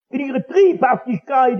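ordinary delivery

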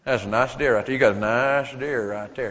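put-on mild Southern accent admiring a deer. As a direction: savour it in a light Southern accent. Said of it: "Yankees can talk like Southerners".

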